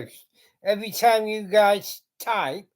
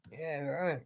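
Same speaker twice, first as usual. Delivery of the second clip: quiet sarcastic aside; a dry, soft scoff under the breath; 5 semitones lower, 14 dB quieter than usual. Real, not acted. Reacting to news headline.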